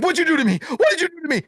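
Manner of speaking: high-pitched panicked imitation; mock panic. Released man to the one who prayed, panicking. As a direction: act out a freaked-out man demanding answers. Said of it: "He starts panicking".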